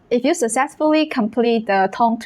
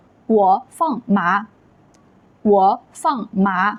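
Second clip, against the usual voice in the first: slow Mandarin demo, tones drawn out; measured teacher's read-after-me delivery; model reading; slower than usual. Shown as a demonstration. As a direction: say each syllable separately for repetition. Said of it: "I'll read it first and you can repeat".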